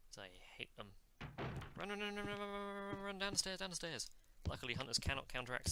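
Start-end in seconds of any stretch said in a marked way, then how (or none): 1.7-4.1 high, held-pitch run chant; playful panic. Pretend. Player fleeing a hunter down the stairs, playful panic. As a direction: rattle the words out high on one note, easing at the end. Urging himself while fleeing downstairs.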